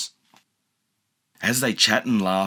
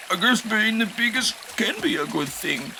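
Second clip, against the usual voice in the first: dinosaur; 12 semitones higher than usual. A narrator's reading. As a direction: realize it gently. Dippy voice.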